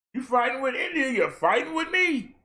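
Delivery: ordinary talk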